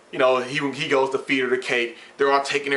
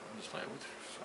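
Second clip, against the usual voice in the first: barely audible disbelieving whisper; shocked whisper; 10 semitones higher, 23 dB quieter than usual. Retold or imitated. Cook overhearing coworkers, whispering in shock. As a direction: breathe it out under your breath, stunned.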